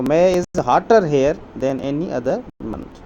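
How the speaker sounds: slow dictation. Shown as a demonstration. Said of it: "जो है hotter होगा".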